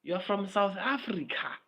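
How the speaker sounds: a hint of a teasing hip hop buddy; mock hip hop guy. Fan of hip hop culture teased by friends, lightly mocking. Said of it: "it's like".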